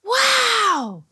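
very high, stretched-out exclamation; squeaky, amazed delight; thrilled wow. A narrator's reading. Billy learns he can fly, high excited wow.

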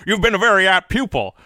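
high, loud drawling Jimmy Stewart; Jimmy Stewart impression. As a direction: do your best Jimmy Stewart. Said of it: "our Jimmy Stewart off".

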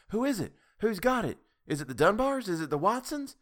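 high, eager questions; excited. A narrator's reading. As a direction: voices jump up in excitement. Women all speak at once.

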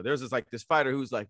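ordinary delivery

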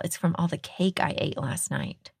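usual speaking voice

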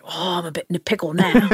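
put-on quick, light British accent. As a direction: fret mildly in a posh British accent. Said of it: "He's like".

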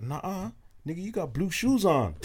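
put-on high, sassy Californian girl voice; sassy American girl. Girl at the party calling out his shoes, sassy and high. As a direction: call him out like a sassy girl. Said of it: "She was like".

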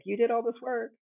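small high mock scream from a cage. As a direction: imitate an indignant inner voice without really shouting. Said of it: "screaming, being like".

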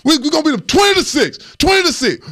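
mock coach shouting the final score. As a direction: yell the score like a promise. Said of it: "he was like".

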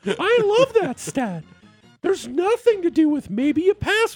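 high, sugary fake-enthusiastic gushing; fake lying voice. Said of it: "this is your line voice".

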